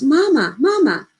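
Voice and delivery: loud, high cry for his mother; screaming. Acted. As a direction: scream for mama in terror. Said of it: "Emmett screams".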